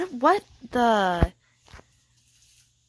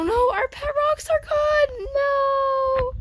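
high wail of dismay; slower than usual. Real, not acted. Pet rocks vanish in the game.

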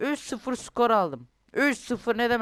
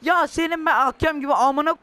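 angry at referee; 6 semitones higher, 7 dB louder than usual. Real, not acted. Cursing the referee.

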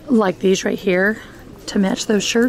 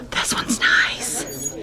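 hushed gasp, then a slow whisper; 4 semitones higher, 5 dB quieter, much slower than usual. Real, not acted. Shopper gasping and whispering at a nice shirt. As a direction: breathe out your admiration.

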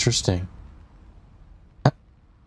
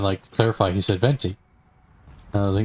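ordinary talk

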